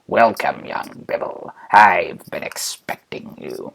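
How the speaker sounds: low, slow creepy Emperor; Palpy. Acted. Emperor welcoming his awaited guest, low sinister Palpy voice. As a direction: creep through it softly, far down in pitch. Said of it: "Good old Palpy turns around".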